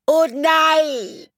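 put-on very high girl's wail of dismay; squeaky panicked oh nein; Elli crying out. A narrator's reading. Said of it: "schrie sie".

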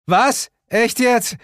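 high, loud disbelief; startled call. A narrator's reading. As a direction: call out in sudden surprise. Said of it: "rief ich".

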